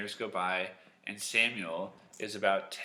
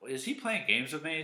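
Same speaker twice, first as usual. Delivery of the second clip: put-on higher, mildly indignant boy; puzzled boy; 6 semitones higher than usual. Young Samuel wondering about Eli, mildly put out. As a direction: ask it with mock exasperation. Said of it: "So Samuel's like".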